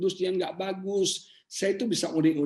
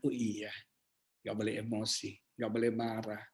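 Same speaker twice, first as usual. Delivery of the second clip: soft, low murmur to oneself; subdued self-reminder; 7 semitones lower, 9 dB quieter than usual. Retold or imitated. Preacher reminding himself not to get angry, quiet and subdued. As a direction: drop right down, as if chastened. Acts himself checking his temper.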